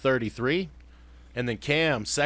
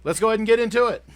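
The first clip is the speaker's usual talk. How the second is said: hyped up; 10 semitones higher, 6 dB louder, much faster than usual. Real, not acted. Kicking off the rundown.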